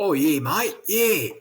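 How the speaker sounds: hammed-up Australian, rising on the last words; imitated Australian accent. Guest showing how locals speak, imitated Australian accent. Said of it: "they speak something like that".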